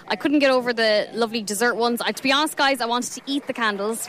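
usual speaking voice

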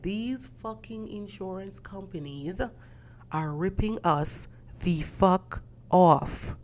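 slow, drawn-out curse. Retold or imitated. Repeats complaint, slower and stronger.

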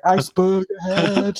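high, loud, slow mock alarm call; Titanic lookout. Comparing the bell to the Titanic, mock alarm. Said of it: "like on the Titanic".

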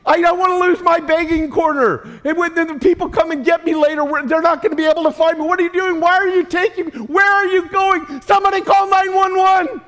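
high, loud panicky protest; whining paralyzed man. Retold or imitated. Paralyzed man protesting to his friends, high and panicky. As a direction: squawk in alarm at being carried off. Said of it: "He's saying".

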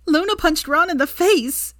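high, slow gleeful squeal; gleeful squeal of news to Draco. A narrator's reading. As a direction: burst out with delighted news. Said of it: "she squealed".

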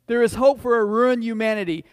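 loud, slow, emphatic refrain; impassioned preaching. Real, not acted. Preacher proclaiming hope to the congregation, loud and measured. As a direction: proclaim each hope deliberately, loudly.

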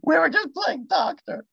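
very high squeaky joking plea; high-voiced fake innocent protest; mock excuse. Listener mimics caught person's excuse.

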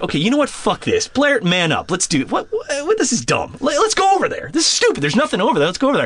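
high, strained pep talk to himself; psyching himself up. Retold or imitated. Talking himself into checking the drapes, high strained voice. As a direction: squeak out a brave pep talk. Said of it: "finally, I'm like".

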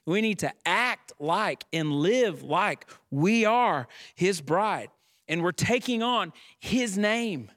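preacher lifting the church to its feet; fervent preaching. Acted. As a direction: proclaim it to the congregation. Preacher builds again.

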